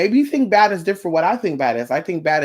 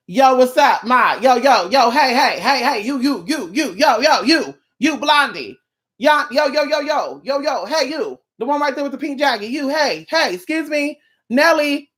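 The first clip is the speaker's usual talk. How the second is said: catcalling guy; 6 semitones higher than usual. Retold or imitated. A man hollering at a woman across a crowd, mocking catcall. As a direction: keep yelling yo yo to get her attention.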